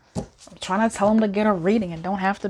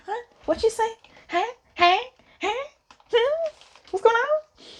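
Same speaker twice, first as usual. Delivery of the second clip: high repeated huhs, baffled; lost and asking around; confused. Retold or imitated. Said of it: "I was like".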